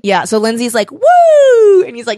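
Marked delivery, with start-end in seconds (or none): 0.9-1.8 loud, high "woo"; long imitated cheer; battle whoop. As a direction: whoop with gleeful abandon.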